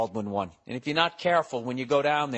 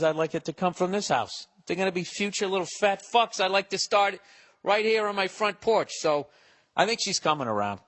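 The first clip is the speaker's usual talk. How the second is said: normal talking voice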